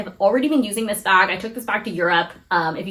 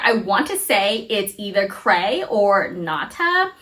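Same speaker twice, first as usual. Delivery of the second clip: ordinary delivery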